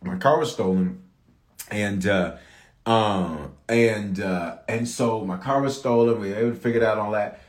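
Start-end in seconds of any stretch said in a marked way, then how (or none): none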